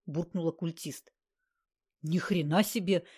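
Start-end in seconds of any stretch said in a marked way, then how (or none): none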